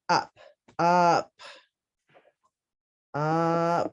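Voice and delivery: up repeated and stressed; syllable drill. Shown as a demonstration. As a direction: pound the syllable up.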